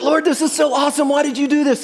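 high, loud awed joy; thrilled prayer. Retold or imitated. Surfer praising God in the waves, high and thrilled. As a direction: marvel out loud at the gift. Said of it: "I'm like".

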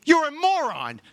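hammed-up disbelief at a fool; exasperated pastor. Pastor shaking the stubborn person, mock exasperated high voice. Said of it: "I'm shaking them".

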